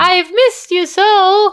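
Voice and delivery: put-on high, slow sugary little-boy voice; wheedling son. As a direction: coo it like a child sucking up. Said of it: "let's reenact the phone call".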